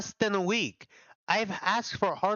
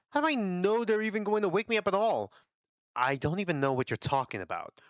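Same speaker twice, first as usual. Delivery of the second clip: ordinary talk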